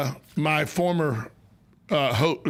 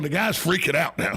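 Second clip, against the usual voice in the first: normal talking voice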